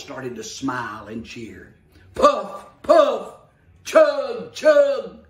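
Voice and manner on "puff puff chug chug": loud, high puff and chug; puffing engine sounds. Acted. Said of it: "said the little blue engine".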